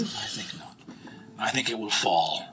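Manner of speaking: very soft, low half-whisper; acted low, breathy solemn reply; grave hushed answer. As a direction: murmur it gravely, with long hesitations. Knight answers grimly about the heir.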